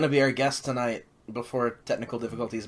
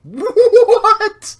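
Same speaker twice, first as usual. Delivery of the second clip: shocked; 12 dB louder than usual. Real, not acted. Shocked by exploding cage match.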